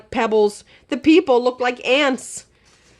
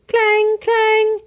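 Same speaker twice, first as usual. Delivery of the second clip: high, ringing clang of an alarm bell; 13 semitones higher, 4 dB louder than usual. A narrator's reading. Narrator voices the monster alarm bell ringing. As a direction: ring it out like a bell.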